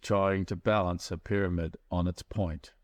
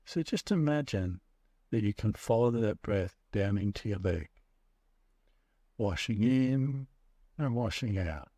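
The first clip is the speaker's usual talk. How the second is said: guided meditation. Acted. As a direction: ease them into the breath, unhurried. Leads a guided breathing meditation.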